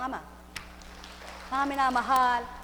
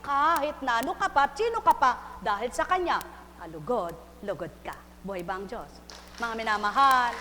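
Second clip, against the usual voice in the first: ordinary delivery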